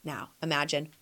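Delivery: normal talking voice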